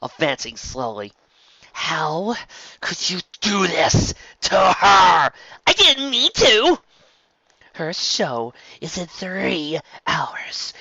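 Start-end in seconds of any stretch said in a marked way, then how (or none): 1.7-5.3 loud, slow high outrage; angry friend advancing on Trixie; angry accusation. Acted. Bon Bon confronting Trixie. 5.7-6.8 high, loud, slow plea; cornered Trixie pleading loudly; panicked protest. Acted. 7.8-10.7 very slow, tense whisper; angry friend whispering urgently. Acted. Bon Bon hissing urgently.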